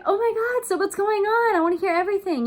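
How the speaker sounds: high and loud gushing; thrilled to catch up with a friend; excited. Shown as a demonstration. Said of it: "you're excited for this phone call".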